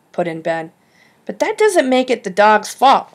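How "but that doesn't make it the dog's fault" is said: higher, louder sharp retort; sharp, raised protest; sharply. A narrator's reading. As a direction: snap back in her defence. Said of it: "Savannah continued sharply".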